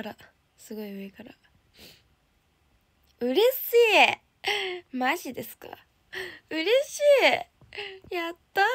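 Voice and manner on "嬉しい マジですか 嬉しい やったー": high, loud cries of joy; delighted cheer. Real, not acted. Idol cheering over a fan's message, delighted. Fan news in chat.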